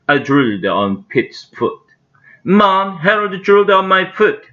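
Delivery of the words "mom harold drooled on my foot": put-on high-pitched tattling kid; complaining boy. A narrator's reading. Boy telling mom about the dog's drool, high complaint. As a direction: call to mother in high protest.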